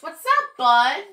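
high, loud playful hello; cheery greeting. Acted. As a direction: greet the buds like pals. Greeting its new buds.

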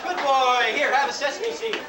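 praising a pet. Acted.

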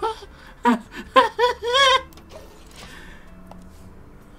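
high-pitched giggling laugh; high giggle. Real, not acted. Laughing at game chaos.

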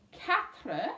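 ordinary talk, nothing marked

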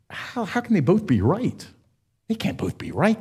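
puzzled question. Retold or imitated. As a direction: ask it like a confused trainee who can't make sense of it. Puzzled associate questions the pastor.

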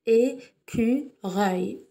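"Écureuil" very slowly in three beats, É, cu, reuil; split into syllables. Shown as a demonstration. Teacher counts three sounds for children, "Écureuil" syllable by syllable. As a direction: pause after each syllable of "Écureuil". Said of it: "nous avons trois syllabes".

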